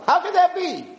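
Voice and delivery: 'How could that be?' pitched high as amazed wonder; mock astonishment. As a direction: squeak 'How could that be?' in awed disbelief. Acted amazed version.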